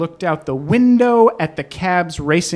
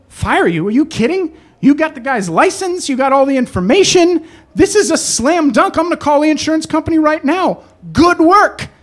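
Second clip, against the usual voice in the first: upbeat, higher boss reassuring the driver; cheerful boss; 6 semitones higher than usual. Retold or imitated. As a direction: brush the worry off brightly.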